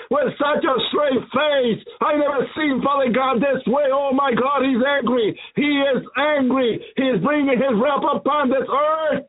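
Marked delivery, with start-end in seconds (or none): none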